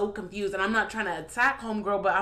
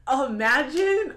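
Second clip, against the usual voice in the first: emphatic; 7 semitones higher, 6 dB louder, slower than usual. Real, not acted. Stressing the scenario.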